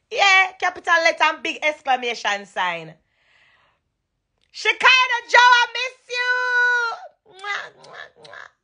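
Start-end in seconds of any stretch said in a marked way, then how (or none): 4.5-8.5 high, stretched cry of longing; wailing call. Real, not acted. Calling out to friend Shekinah Joe, high stretched wail. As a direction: call out like you really miss her.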